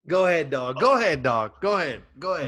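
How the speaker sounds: loud, egging him on; hyping up. Real, not acted. Hyping his co-host on with a loud egging cheer.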